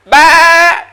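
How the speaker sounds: high, loud drawn-out bleat; goat bleat imitation. Shown as a demonstration. Teacher sounding out a goat for listeners, loud imitated bleat. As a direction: bleat one long loud "baa" like a goat. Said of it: "It doesn't mean goat voice".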